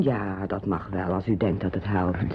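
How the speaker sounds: low, soft hesitant yes; quiet shy consent. Acted. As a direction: agree softly, a little taken aback.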